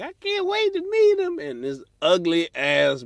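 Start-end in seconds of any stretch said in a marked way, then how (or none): 0.0-1.4 very high, breathy-free falsetto of a hopeful date; eager woman's voice. Retold or imitated. Hopeful woman on a blind date, in a high falsetto. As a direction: squeal it like a woman excited for her date.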